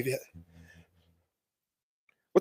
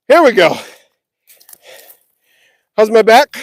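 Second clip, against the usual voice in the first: usual speaking voice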